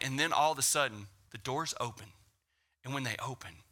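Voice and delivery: ordinary delivery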